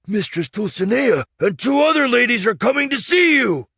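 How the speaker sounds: high and loud, bursting with news; high, loud excited announcement; excited exclaim. Acted. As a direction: squire rushing to tell his master. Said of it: "he exclaimed".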